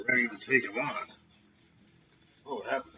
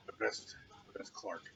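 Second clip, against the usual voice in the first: muffled raspy voice through a mask; raspy behind mask; 11 dB quieter than usual. Real, not acted. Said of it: "makes my voice a little raspy with this mask on".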